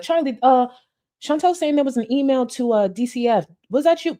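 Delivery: high-pitched mock questioner; imitated confronting critic. Mimicking someone asking Charlie about a reported email, high mock-inquiring voice.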